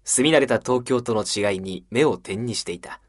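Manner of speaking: slow, measured narrator voice; travel show narration. Acted. Narrator opens a travel-show skit, slow storytelling. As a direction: tell a traveller's tale at an easy, slow pace.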